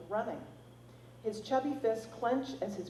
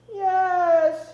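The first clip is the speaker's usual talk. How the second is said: put-on high, loud child's wail; 11 semitones higher, 15 dB louder than usual. A narrator's reading. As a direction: wail it out like a hurt toddler. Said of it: "he wails".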